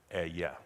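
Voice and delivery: "Ehyeh" said as eh-YEH in Hebrew, soft and low; Hebrew pronunciation. Shown as a demonstration. Preacher showing the congregation the Hebrew word, softly. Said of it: "That's how you say it in Hebrew".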